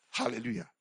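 normal talking voice

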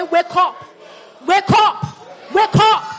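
loud, high preacher's cry; rousing shout. Real, not acted. Preacher urging the congregation to repeat, loud rousing shout. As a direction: shout it to wake the room.

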